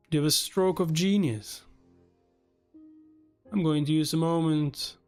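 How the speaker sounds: ordinary talk, nothing marked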